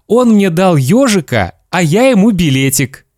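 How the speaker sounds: put-on high, eager child voice; boy explaining. Boy explains the swap to father.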